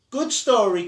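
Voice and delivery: a cheerful shout up to a window; called out. A narrator's reading. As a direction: call it out to the boy in the window. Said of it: "called the girl".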